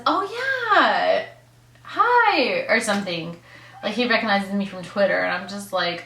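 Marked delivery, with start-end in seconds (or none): 0.0-2.6 mock greeting. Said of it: "points to me and says".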